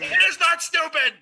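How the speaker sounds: high offended yell; indignant shout. Acted.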